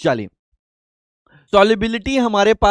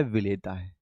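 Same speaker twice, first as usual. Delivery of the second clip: विलेता है said low and very soft; soft model answer; 9 semitones lower, 12 dB quieter than usual. Shown as a demonstration. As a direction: prompt the answer softly. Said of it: "teacher asks, class echoes".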